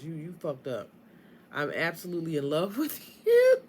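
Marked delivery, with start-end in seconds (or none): 1.5-3.6 louder, breaking up into laughter; hammed-up love line dissolving into a laugh; laughing declaration. Reader voicing the partner's love to Scorpio, laughing.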